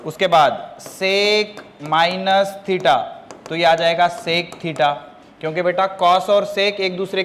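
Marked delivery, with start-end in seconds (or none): 0.8-3.0 very slow, word by word; slow dictation. Shown as a demonstration. Teacher giving the next formula, slow dictation. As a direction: dictate with each word drawn out.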